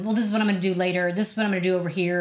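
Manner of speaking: fast, hurried mental to-do list; racing thoughts about later; rushed inner planning. Retold or imitated. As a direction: rattle off plans like a busy mind.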